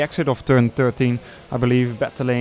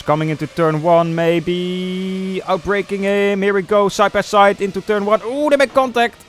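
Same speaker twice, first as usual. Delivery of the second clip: rising excitement, higher and louder; excited commentary; 7 semitones higher, 4 dB louder than usual. Real, not acted. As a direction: call the battle as it happens. Live race battle commentary.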